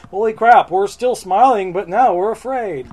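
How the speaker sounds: hammed-up fright; mock alarm. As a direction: play startled monsters for laughs. Monsters alarmed in retold story.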